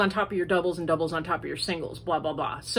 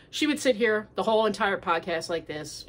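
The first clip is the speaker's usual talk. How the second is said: normal talking voice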